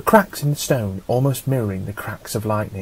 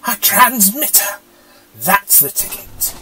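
higher, slower, delighted; pleased discovery; 9 semitones higher, slower than usual. A narrator's reading. The Doctor spotting the transmitter, pleased and higher. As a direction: savour the find.

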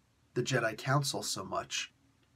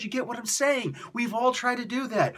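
ordinary talk

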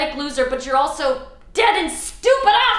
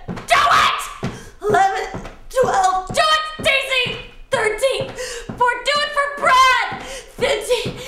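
high, loud strained cheers; cheering herself on mid-jumping-jacks, pitched high; frantic cheering; 11 semitones higher, 5 dB louder, slower than usual. Acted. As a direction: push through the last reps. Frantic pushing through exercise.

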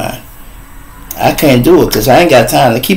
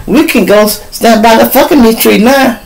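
put-on girlfriend's voice; 10 semitones higher than usual. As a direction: play her excited and eager, pitched way up. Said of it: "she like".

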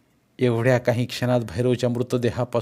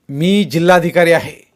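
raised, forceful declaration; loud assertion; 4 semitones higher, 8 dB louder than usual. A narrator's reading. Jaydeep tells the masked man his rank, loud and forceful. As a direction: assert your rank over the noise.

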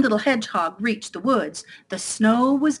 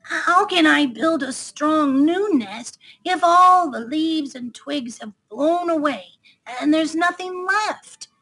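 high-pitched worried little hedgehog; anxious question; 7 semitones higher than usual. Acted. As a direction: fret aloud, voice pitched up. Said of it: "he asked anxiously".

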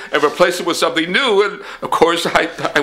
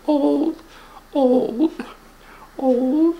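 higher pompous rich man; 8 semitones higher than usual. Acted. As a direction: puff up as the rich boss.

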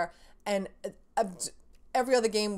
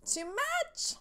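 disgusted squeal; 4 dB quieter than usual. Real, not acted. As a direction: whisper then high squeal. Disgust at game mechanic.

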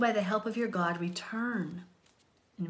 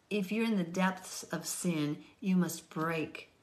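normal talking voice

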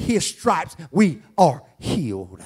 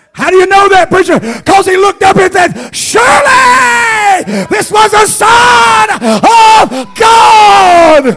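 much higher, much louder whoop, slow with a crying break on the Son of God; preaching at full force; 10 dB louder, slower than usual. Acted. Preacher whooping the centurion's words to the congregation, much higher and louder. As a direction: wail it out to the church.

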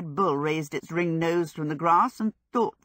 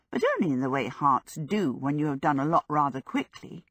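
plain reading voice

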